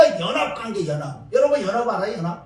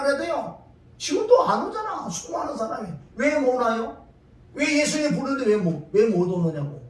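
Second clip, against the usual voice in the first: ordinary talk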